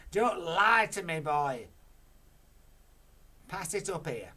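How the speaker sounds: very loud command; Grandma orders George, loud; bossy order. Acted. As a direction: demand the cup.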